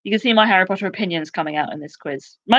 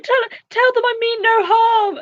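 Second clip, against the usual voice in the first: much higher, mock pleading squeak; pleading Umbridge. Umbridge carried off by trolls, high mock pleading. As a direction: beg feebly like a prim villain being dragged off. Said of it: "she's like".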